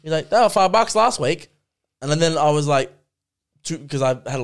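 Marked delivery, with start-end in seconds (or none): 0.3-1.5 much higher and louder complaining customer; retold angry yell. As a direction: shout it like an outraged shopper. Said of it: "You screamed at me saying".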